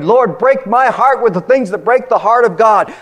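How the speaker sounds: high, loud cry of the prayer; fervent preacher's plea; loud impassioned prayer. Acted. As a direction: proclaim the prayer to the rafters. Prayer quoted in full-force preaching.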